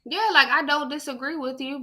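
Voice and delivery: normal talking voice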